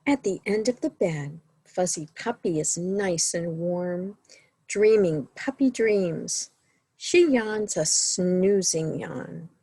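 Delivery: lively, sing-song picture-book reading; reading to children. A narrator's reading. Librarian reading a bedtime story to children, sing-song. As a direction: read the bedtime book to the little ones.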